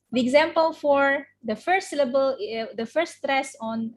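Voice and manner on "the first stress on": ordinary talk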